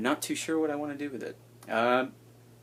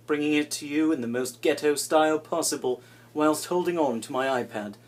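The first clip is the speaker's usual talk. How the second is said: a light mock British accent for the greeting; put-on British accent; 6 dB louder than usual. YouTuber greeting viewers in a mild put-on British accent. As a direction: play a posh British host, lightly. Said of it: "I'm gonna stop using a British accent".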